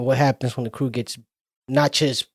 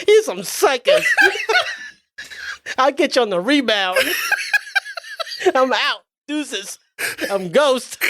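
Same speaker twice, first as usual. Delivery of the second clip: cocky exit taunt; 10 semitones higher than usual. Retold or imitated. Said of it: "takes off".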